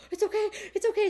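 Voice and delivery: high, gentle comforting through held-back tears; reassuring. Retold or imitated. Comforting a crying bunkmate, high and gentle. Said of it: "I'm like".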